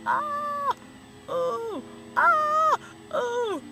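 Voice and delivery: high yelps of surprise at the lights; startled yelps. Real, not acted. Driver yelping at the flashing lights, high and startled. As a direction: yelp out at the flashing glare.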